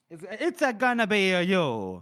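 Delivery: hammed-up Styrian accent, sing-song lilt. Retelling Dao's threat to Calder, mock Styrian accent. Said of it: "Says it with a Styrian accent".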